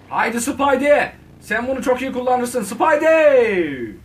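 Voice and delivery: coaxing Spidey. Pretend. Gamer cheering on Spidey, slow high coaxing. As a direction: urge him on like a cheering fan. Coaxing the game character along.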